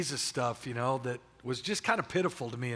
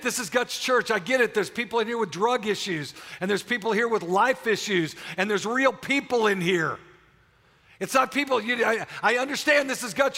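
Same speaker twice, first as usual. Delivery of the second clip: much higher and louder, driving the message home; full-force preaching; 8 semitones higher, 6 dB louder than usual. Acted. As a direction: preach it hard to the congregation.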